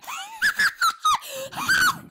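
high shrieking laugh. Real, not acted. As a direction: burst into a shrill laugh. Loud laugh burst.

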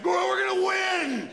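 high, slow, drawn-out jock yell; a meathead whooping about the game; mocking a sports fan, a high slow shouted cheer. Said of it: "there's just people that are just like".

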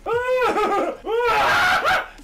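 high, loud whooping laugh; shrieking laughter. Real, not acted. Player as the team goes down, shrieking laughter.

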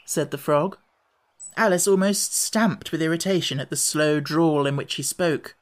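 ordinary delivery

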